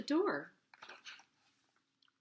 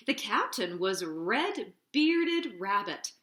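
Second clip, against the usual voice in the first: ordinary delivery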